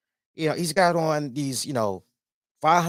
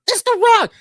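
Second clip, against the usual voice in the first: very high, loud excited yell; excited shout; 10 dB louder than usual. Retold or imitated. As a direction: shriek it like a starstruck fan. Acting out spotting a celebrity.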